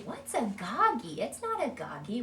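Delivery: put-on the wrong way to answer a child; correcting. Shown as a demonstration. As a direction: question the child's word, puzzled. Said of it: "we don't wanna say".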